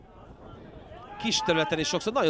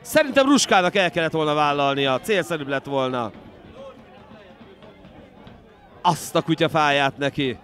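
excited hungarian sports commentary; 2 semitones higher, 7 dB louder than usual. Real, not acted. After the near miss.

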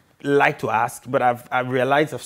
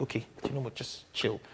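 much softer, low aside to oneself; quiet self-talk; 3 semitones lower, 14 dB quieter than usual. Retold or imitated. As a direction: drop the volume as if calming yourself down. Retelling telling oneself to chill.

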